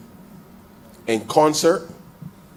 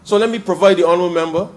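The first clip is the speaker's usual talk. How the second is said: louder, driving debate speech; fiery parliamentary oratory; 4 semitones higher, 7 dB louder than usual. Real, not acted. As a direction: hammer the point home to the House. MP rises to full parliamentary oratory.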